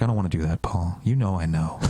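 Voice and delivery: ominous friend. Retold or imitated. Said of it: "And he's like".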